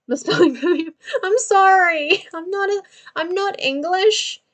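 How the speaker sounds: high, laughing sorry with stretched words; laughing apology. Real, not acted. Laughing apology to English chat.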